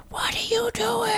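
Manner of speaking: harsh whispered shout; whisper yelling. Shown as a demonstration. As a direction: hiss it like a shout. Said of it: "you can whisper scream".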